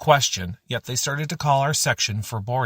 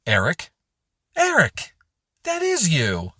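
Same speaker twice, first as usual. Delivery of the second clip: calling out a name, jumping much higher on the repeat; continued yelling; 7 semitones higher, 3 dB louder than usual. A narrator's reading. Embarrassing a friend across the terminal, building yell. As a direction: holler to get a friend's attention, building to a high cry. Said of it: "I continued to yell".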